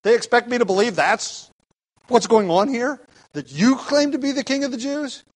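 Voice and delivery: high-pitched disbelieving questions; baffled, can't believe what he's being told; incredulous. Acted. Pilate reacting to the charge against Jesus, incredulous. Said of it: "he's incredulous".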